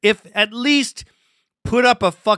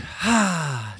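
weary sigh; 2 semitones lower, 7 dB quieter than usual. Real, not acted. Rant winding down in disgust.

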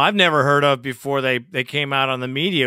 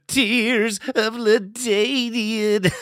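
higher, slow teasing stretch of LaDainian; mock whine; 5 semitones higher, slower than usual. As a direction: draw out the name to mock him. Host mocks LaDainian's tears.

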